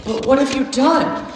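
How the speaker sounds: put-on high childlike question; little girl. A narrator's reading. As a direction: ask it like a worried child. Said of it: "asked Lucy".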